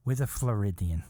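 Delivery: ordinary delivery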